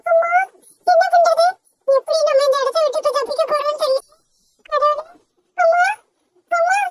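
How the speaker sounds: high, anxious little bird voice; a tiny bird panicking as the eagle stares; high-pitched scared sparrow, words rushing; frightened little sparrow. Acted.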